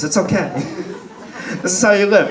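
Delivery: normal talking voice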